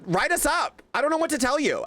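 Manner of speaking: high, dismissive write us up; high defiant retort. Retold or imitated. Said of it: "I was like".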